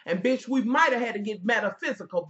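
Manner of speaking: ordinary talk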